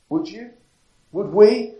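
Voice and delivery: ordinary delivery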